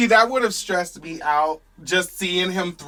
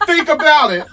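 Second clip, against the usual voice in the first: put-on French accent; 8 semitones higher, 8 dB louder than usual. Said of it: "I don't have a French accent".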